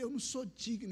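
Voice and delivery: high, soft plea of unworthiness; retold collapse, small high pleading voice; breaking down. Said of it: "Aí eu desabei, eu falei". (súplica desabada, aguda e baixa)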